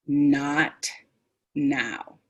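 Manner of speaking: "Not now" stretched, each word apart; emphasized vowels. Shown as a demonstration. Presenter stopping an upset person, drawn-out "Not now". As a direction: hold the vowels in "Not" and "now", firm and calm. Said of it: "I've emphasized the vowel sounds".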